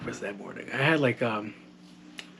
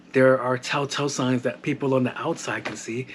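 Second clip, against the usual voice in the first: normal talking voice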